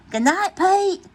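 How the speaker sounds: high bright goodnight call. Acted. The friends call goodnight to Pete brightly. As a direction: sing it out as the group.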